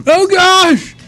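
high, loud startled exclamation; startled shout. Real, not acted. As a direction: blurt it out in shock. Camera dropped, exclaiming.

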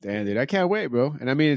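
normal talking voice

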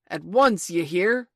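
loud, imperious captain's demand; threatening pirate bellow; commanding cry. A narrator's reading. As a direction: shout the order, allow no argument. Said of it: "cried Peter".

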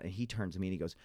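ordinary talk

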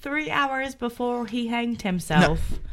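put-on British accent drifting into Irish; Irish accent slip. Guest repeats the timeline, her British accent slipping into Irish. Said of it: "You slipped into an Irish accent".

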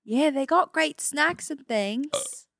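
put-on Australian accent. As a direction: stretch it out in amateur Australian. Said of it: "we're Australian tourists".

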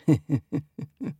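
sly storybook fox laugh; fox chuckle. Acted. Foxy laughing as he plots, acted chuckle. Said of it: "Foxy laughed and rubbed his chin".

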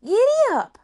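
very high, loud holler; calling to horse. Acted. Rose urging the horse to go, loud high call.

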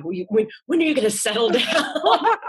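high, laughing nagging mom voice; playful impression of a nagging mother, pitched high; imitating nagging mom. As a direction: tease like a pestering mother, half laughing.

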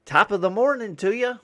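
high, loud stage-Irish lilt; hammed-up Irish greeting; Irish accent. Mailman trying an Irish slogan, high and loud. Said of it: "not like Irish or Scottish or something?".

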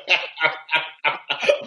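sustained shared laughing fit. Real, not acted. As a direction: keep on laughing, unable to stop. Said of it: "We should stop laughing".

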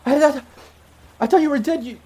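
high, loud, disbelieving outburst; freaking out. Acted. Ex-girlfriend finds lost love alive, freaking out at high pitch. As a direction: stammer it out, overwhelmed with shock. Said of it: "she just freaks out".

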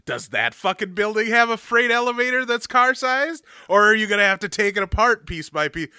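loud, high sarcastic rant at the filmmakers; angry rant. Retold or imitated. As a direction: berate them mockingly. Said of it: "I was so angry".

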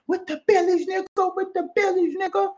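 very high, held monotone squeal; mock falsetto. Mimicking the crew still out here, in a very high mock falsetto. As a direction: imitate them in a piercing falsetto chant.

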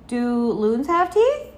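high, loud, slow question; child's rising query; curious little girl. A narrator's reading. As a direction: draw it out with wonder. Child keeps questioning father.

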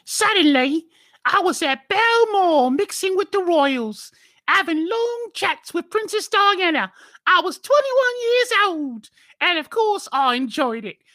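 very high, loud British woman; hammed-up British falsetto, drawn out; shrieky put-on British accent reading a quote; mock British accent. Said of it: "now you have to do a fancy accent for her".

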